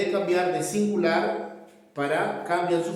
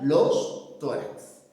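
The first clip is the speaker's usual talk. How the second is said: los tórax drawn out very slowly; slow model of los tórax; slow demo; 2 semitones lower, much slower than usual. Shown as a demonstration. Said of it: "Si es plural".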